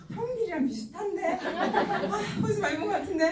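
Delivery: high-pitched mock puzzlement; puzzled onlooker. Passer-by half recognizing an old acquaintance, puzzled. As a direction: wonder aloud, pitched well up.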